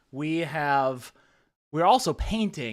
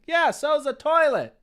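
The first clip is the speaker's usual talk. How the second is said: quoting Cool Runnings; 11 semitones higher, 5 dB louder than usual. Retold or imitated. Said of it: "That's my one Cool Runnings quote".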